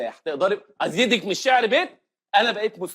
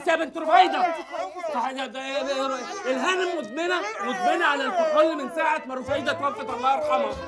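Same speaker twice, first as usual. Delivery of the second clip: high-pitched agitated explaining and hushing; worked-up, raised-pitch telling-off; flustered scolding; 6 semitones higher than usual. Acted. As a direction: scold and make excuses in a fluster. Flustered excuses, silencing the drunk woman.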